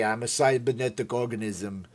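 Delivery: Austrian cyborg voice; Arnold. Acted. Streamer quoting Terminator to viewers, understated Arnold impression. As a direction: recite it as the Terminator. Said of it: "I love the first Terminator".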